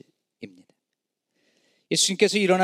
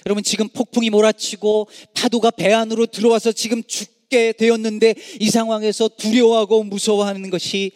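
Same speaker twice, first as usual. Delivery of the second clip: ordinary talk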